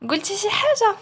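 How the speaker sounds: high-pitched, pointed question; challenging. Acted. Ayat confronting Aroua over what he said, challenging. Offended wife challenges the man.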